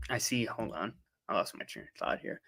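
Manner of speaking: very soft, low mumble to oneself; quiet, distracted aside; muttered aside. Real, not acted. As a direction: mutter it under your breath, barely voiced. Aside while losing track reading chat.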